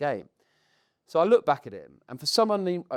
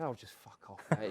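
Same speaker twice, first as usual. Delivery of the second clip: much softer, dismissive aside; retold grumble; 11 dB quieter than usual. Bowler walking past the cheering batter, quiet grumble. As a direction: imitate a fed-up bowler under his breath.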